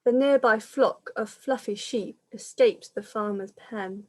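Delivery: lilting rhyming storybook read-aloud; reading to children. Acted. Reader telling children the pandemic story, lilting storytelling. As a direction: carry the rhyme along for little listeners.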